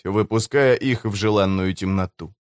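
ordinary delivery, nothing marked

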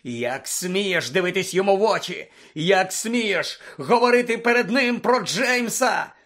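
thundering fury; enraged roar. Acted. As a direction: roar each accusation, drawing out the words. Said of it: "заревів Блек".

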